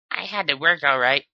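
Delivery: high loud sly Dash; Dash's voice. Acted. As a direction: slip in the double meaning. Dash joking suggestively.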